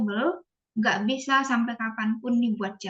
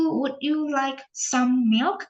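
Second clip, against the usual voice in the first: slow model offer; slower than usual. Shown as a demonstration. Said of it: "kayak misalnya".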